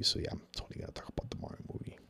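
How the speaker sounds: quick unvoiced whisper; fast conspiratorial whisper. Real, not acted. Sneaking the Mario movie topic to viewers, fast whisper. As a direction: hurry it out under your breath like a secret.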